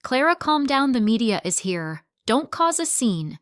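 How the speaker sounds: firm call. Acted. Marcus restraining Clara.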